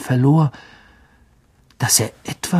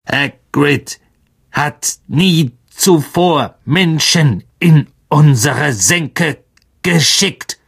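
slow, high spider voice, every syllable detached; Aragog; 3 semitones higher, 4 dB louder, slower than usual. A narrator's reading. The old spider doubting the intruders, slow clicking creature voice. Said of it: "klickte bei jedem Wort mit ihren Greifzangen".

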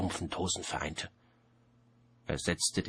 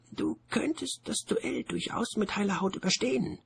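much higher, otherworldly voice; a strange disembodied voice, pitched up high. A narrator's reading. The Voice warns him about the duel, high and otherworldly. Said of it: "sagte die Stimme".

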